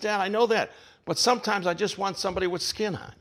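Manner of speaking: a hint of a child pleading with Dad; little boy's plea. Frightened boy answering his father.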